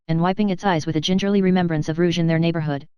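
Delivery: ordinary delivery, nothing marked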